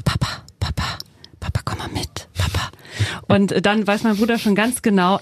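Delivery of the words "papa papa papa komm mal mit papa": put-on breathy secret whisper of a little boy begging his dad; soft, sneaky child's whisper; imitated toddler whisper. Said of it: "flüstert mit meinem Bruder".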